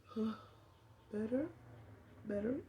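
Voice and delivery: soft, quiet relieved murmur; quiet and relieved in the car; relieved sigh. Retold or imitated. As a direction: let the relief out softly. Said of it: "everything got quiet".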